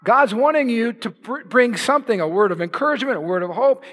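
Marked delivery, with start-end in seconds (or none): none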